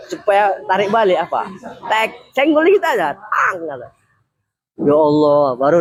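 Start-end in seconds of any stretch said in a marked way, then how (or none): 1.9-3.9 high playful sound effects, tek and tang; mock nudge sounds. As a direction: pop the sounds out high and light. (bunyi senggolan tinggi dan main-main)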